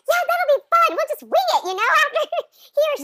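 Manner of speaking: high, loud, sing-song breezy voice; high loud mock-breezy chirp with a laugh; mock carefree. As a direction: play your naive past self, laughing at it. Mocking her own carefree self.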